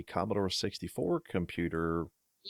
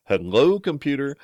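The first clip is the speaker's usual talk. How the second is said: much louder, higher "Hello"; Scotty into the mouse; 8 semitones higher, 11 dB louder than usual. Retold or imitated. Host reenacting Scotty talking into the mouse, loud mock greeting. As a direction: speak into the mouse like a microphone. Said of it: "and he goes".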